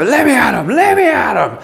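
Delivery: an impatient youngster itching to fight; eager young hothead. Retold or imitated. Eager youth voiced in sermon.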